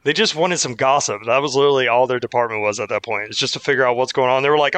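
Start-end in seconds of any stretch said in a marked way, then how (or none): none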